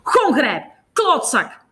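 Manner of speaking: loud, high-pitched yell; shouted abuse over a microphone; angry shout. A narrator's reading. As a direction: call out the insult in fury. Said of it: "roept Jamie".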